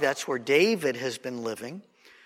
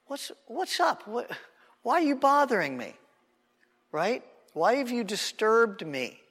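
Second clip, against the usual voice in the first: annoyed Samuel; 7 semitones higher than usual. Retold or imitated. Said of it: "Samuel's like".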